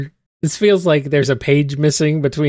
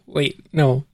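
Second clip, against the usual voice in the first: normal talking voice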